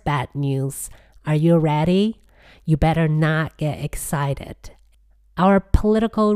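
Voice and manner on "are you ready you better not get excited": ordinary talk, nothing marked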